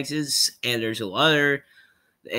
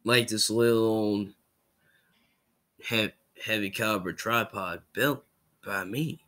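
lightly voiced Southern engineer; quieter, low-pitched, measured delivery; a hint of a laconic threat; 3 semitones lower, 5 dB quieter than usual. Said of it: "I just did Meet the Engineer".

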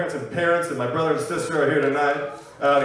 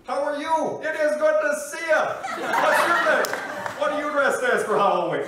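put-on much higher, friendly voice for talking to children; Barack Obama; 11 semitones higher, 3 dB louder than usual. President greeting children at Halloween, high and friendly. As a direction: bend down and coo to a little kid, high-pitched. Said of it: "his voice gets higher. He'll bend down, be friendlier".

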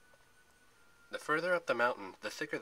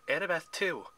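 higher-pitched, emphatic addition; insistent; 6 semitones higher, 4 dB louder than usual. A narrator's reading. Thalia backs up Zoe's demand, insistent and raised. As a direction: chime in firmly with a demand.

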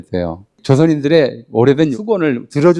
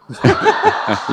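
sudden high-pitched laugh. Real, not acted. Laughs at own coinage.

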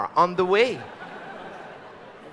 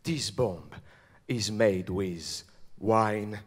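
very low, hushed superhero voice; Batman; 11 semitones lower, 11 dB quieter than usual. Acted. Superhero baffled by a bomb of wine and cheese, deep hushed voice. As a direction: keep it deep, soft and deadly serious. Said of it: "and he said".

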